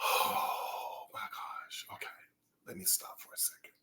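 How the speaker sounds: whispered after a long sigh; sigh, then whisper to yourself; hushed, overwhelmed. Real, not acted. Vlogger pausing the review for a second, hushed whisper.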